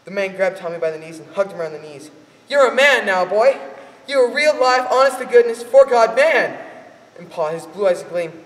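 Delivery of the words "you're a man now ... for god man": loud, high, rowdy praise; man in bar cheering the boy, loud. Acted.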